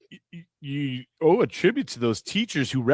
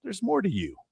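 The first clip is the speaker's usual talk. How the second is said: pitched well up, softer, kindly teacher; encouraging teacher; 9 semitones higher, 4 dB quieter than usual. Retold or imitated. Imitating a teacher telling the student there's more, pitched-up encouragement. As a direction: lift the voice to encourage.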